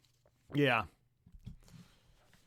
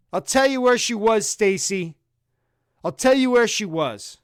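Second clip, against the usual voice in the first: mock rant; 7 semitones higher, 6 dB louder than usual. Ranting at Stacy.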